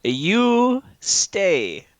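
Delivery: high, loud, drawn-out order; Walder commanding. Acted. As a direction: Walder ordering the driver, pitch swooping. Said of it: "he points at the driver and he says".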